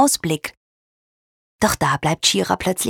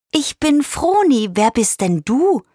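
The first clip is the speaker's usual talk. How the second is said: high-pitched, tentative introduction; high, wary bird voice; cautious bird; 6 semitones higher than usual. A narrator's reading. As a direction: ask carefully as a little bird. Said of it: "fragt sie vorsichtig".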